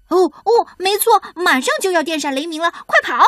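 high, hurried storm warning; urgent alarm. A narrator's reading. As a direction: rush the warning out and urge them to run. Storm warning, urging everyone to run.

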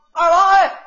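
loud high call down the street. Acted. Calling after his friend from behind, loud and high. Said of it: "你喊我一回".

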